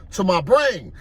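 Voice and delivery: usual speaking voice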